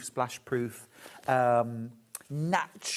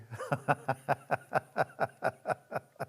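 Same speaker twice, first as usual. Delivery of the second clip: laugh; 5 dB quieter than usual. Real, not acted. Laughs at calling herself dolly.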